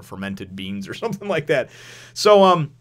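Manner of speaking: ordinary delivery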